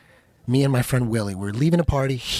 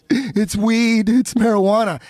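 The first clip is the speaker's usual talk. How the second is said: high, gasping mock-weepy confession; imitating a panicked crybaby; mock crying; 6 semitones higher, slower than usual. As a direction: confess through hammed-up sobs. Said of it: "I was just crying like a fucking little bitch".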